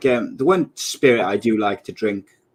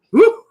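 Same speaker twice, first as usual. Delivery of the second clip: high, loud "woo!"; whooped exclamation; 7 dB louder than usual. Real, not acted. Host recovering from cracking himself up, high whoop. Reacting after a laughing fit.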